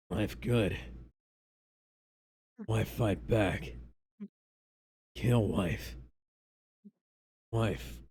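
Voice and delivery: low, slow, broken caveman grunts. Acted. Bucky explains his wife to Steve, low caveman delivery. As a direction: drop low and chop each word. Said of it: "Never doing the voice".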